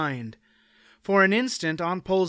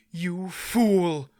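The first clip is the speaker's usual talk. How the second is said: raged; 3 semitones higher than usual. A narrator's reading. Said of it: "Aunt Pole raged".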